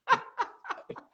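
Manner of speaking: a silent wheezing laugh; high breathless laugh. Real, not acted. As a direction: laugh without voice at the memory. The burnt water punchline. (risada aguda sem voz)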